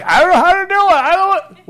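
high, loud mock whine; whiny excuse. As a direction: whine like a sulking spouse. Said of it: "So don't act like".

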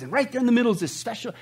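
usual speaking voice

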